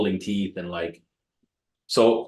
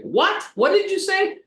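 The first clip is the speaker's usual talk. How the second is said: put-on shrill, much higher baffled old lady; little old lady. Old lady at the door, puzzled, squeaks back. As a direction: squeak out a confused old woman's what. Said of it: "this little old lady answers the door".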